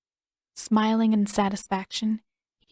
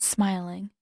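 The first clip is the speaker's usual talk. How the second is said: usual speaking voice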